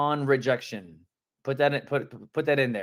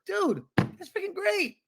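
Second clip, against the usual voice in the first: "Dude" leaps much higher, stretched slowly; excited upswing. Shown as a demonstration. Coach shows an upswing to a cousin-realtor rejection, thrilled. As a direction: burst with delight. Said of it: "have an upswing in your tone".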